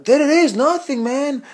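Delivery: high, dismissive mock Brazilian accent; Renato Laranja. Dismissing a rival fighter, imitated Brazilian accent. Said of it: "he talks with this Brazilian accent, really thick".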